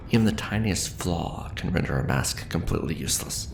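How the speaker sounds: ordinary talk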